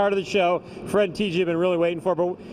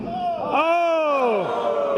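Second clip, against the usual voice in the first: surprised shout; 7 semitones higher than usual. Real, not acted. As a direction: react to the near miss. Live toss, big miss.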